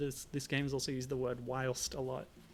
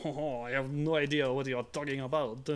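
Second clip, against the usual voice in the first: loud, snooty Frenchman; snooty French accent; 6 dB louder than usual. Acted. Player voicing a snooty Frenchman denying everything, hammed-up French accent. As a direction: put on a haughty French accent. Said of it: "snooty Frenchman".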